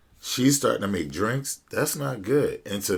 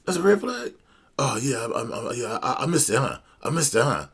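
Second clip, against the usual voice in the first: much higher, sheepish imitation of the friend; mock the friend's high, caught-out voice; 7 semitones higher, faster than usual. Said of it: "he's like".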